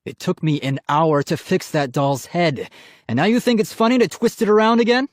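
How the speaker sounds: scolding that climbs and speeds up; angry demand. A narrator's reading. Dad scolding son about the fixed doll, angry. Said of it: "his dad demanded angrily".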